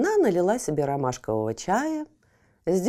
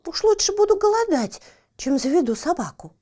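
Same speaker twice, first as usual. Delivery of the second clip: high, loud, huffy refusal; indignant cat; 8 semitones higher, 4 dB louder than usual. Acted. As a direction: refuse with wounded pride. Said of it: "возмутилась Барбариска".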